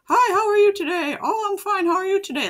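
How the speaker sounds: high, loud cheery greeting voice; bright sing-song character hello; squeaky cartoon greeting. Shown as a demonstration. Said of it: "making them talk like".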